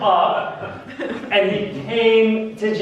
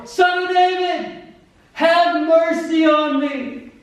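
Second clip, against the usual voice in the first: high, drawn-out shouted plea; shouting all the more; 11 semitones higher, 4 dB louder, slower than usual. Acted. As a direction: bellow it slowly over the crowd. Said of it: "he shouted all the more".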